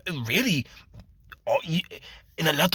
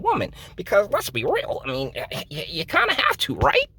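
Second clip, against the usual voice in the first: much higher, partly fast cartoonish lech; silly high voice; 6 semitones higher, 3 dB louder than usual. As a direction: squeak it out like a goofy ogling creep. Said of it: "I have used three voices already".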